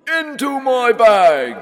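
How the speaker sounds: very high, loud, slow command; monster shout. A narrator's reading. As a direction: bellow the order. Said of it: "shouted the monster".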